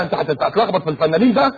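ordinary delivery

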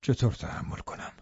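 soft, hushed, hopeless plea; grief and despair. A narrator's reading. Lover to dying woman, hushed despairing plea. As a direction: let the despair leak through, barely voiced.

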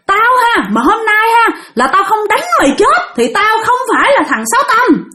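high-pitched acted threat from an enraged husband; mock bellow, pitched high without much extra volume; angry hollering. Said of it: "Là ổng la làng lên vậy".

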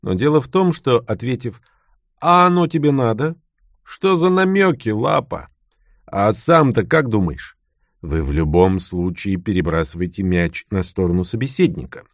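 ordinary delivery

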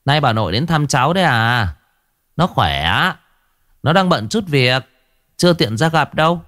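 a hint of a sneering hostess; lightly voiced cold woman. A narrator's reading.

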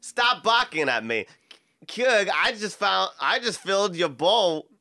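hammed-up Boston accent, R's dropped; Boston accent drifting toward Christopher Walken. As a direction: do an amateur Bostonian.

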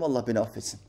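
quietly quoted penitence; humble confession. Retold or imitated. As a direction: drop the voice into contrition. Quoting a leader's humble confession.

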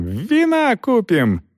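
high squeal, vowels stretched. A narrator's reading. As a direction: squeal with glee, slow and high. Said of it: "визжала рыжая".

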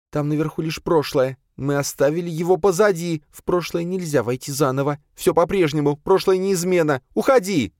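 high-pitched insistent voice; high, heated, rising to a cry; agitated refusal. A narrator's reading. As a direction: insist frantically, building to a dismissal. Agitated woman rejecting the ghost.

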